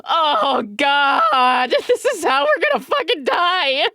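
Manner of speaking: retold terror on landing, hammed-up; mock panic. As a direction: yelp as the plane lurches. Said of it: "I was like".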